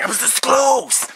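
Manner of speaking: high, loud cry of dismay; frustrated yell. Retold or imitated. Narrator missing the killer with the RPG, a loud high cry. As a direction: yell at the near miss. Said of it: "I'm like".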